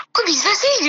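high, astonished self-quote; baffled disbelief. Retold or imitated. Woman recalling her surprise at an early pregnancy, baffled. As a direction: exclaim it high, like a bewildered first-timer.

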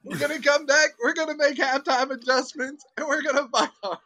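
high, loud halftime rallying cry; hammed-up locker-room shouting; mock coach speech. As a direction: fire up the team at halftime.

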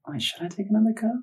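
high, soft, quick puzzled thought; hushed self-questioning. Retold or imitated. Man answering the thought in his mind, hushed and unsure. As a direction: wonder it to yourself under your voice.

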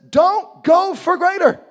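high, loud, drawn-out shout; preacher shouting a false commandment; mock command. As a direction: proclaim it slowly at full volume. Mock command Jesus never gave.

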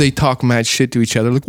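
usual speaking voice

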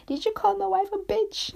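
imitated dad's playful accusation, voice shooting up; joking host dad. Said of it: "my host dad would joke around".

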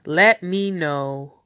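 ordinary delivery

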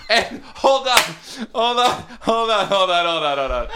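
high, laughing hold on. Real, not acted. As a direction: gasp out hold on through laughter. Laughing, trying to continue.

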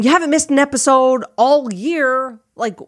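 high-pitched, pushy inner critic; pushy Ursula. Retold or imitated. Inner critic pushes podcaster to keep going, high and pushy. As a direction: goad with a mock pep talk. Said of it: "that voice in my head".

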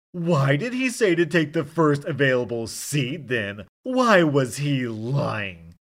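loud, high, slowed mock scream. Drunk passenger screaming at the attendant about being lied to, loud slow scream. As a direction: scream it out, dragging the last words. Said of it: "Dirk is full on screaming now".

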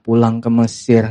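low-pitched, unimpressed repeat; dismissive echo. Real, not acted. Preacher reacts to Israelites' complaint, low and dismissive. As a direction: drop low, weary of their nonsense.

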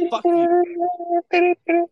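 high, loud mock shout of fuck you; mock yell. Mock outburst at podcast segment.

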